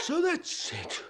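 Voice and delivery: high, slow, half-laughing sneer; bitter laugh. Acted. Father realises the family is against him, slow bitter laugh. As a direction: laugh it out as if it all makes sense now.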